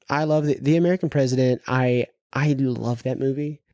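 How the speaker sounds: usual speaking voice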